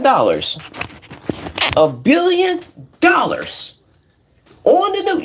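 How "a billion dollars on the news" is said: mock news hype. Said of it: "guys, I'm gonna show you".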